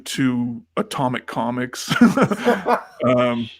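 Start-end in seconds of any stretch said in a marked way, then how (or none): 2.0-3.0 high-pitched chuckle; short burst of real laughter. Real, not acted. Laughs after naming the shop.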